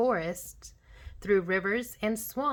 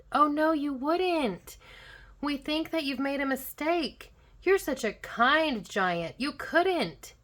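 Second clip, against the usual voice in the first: put-on high, earnest child's protest; a child appealing to the giant's kinder side; protesting children; 6 semitones higher, 3 dB louder than usual. A narrator's reading.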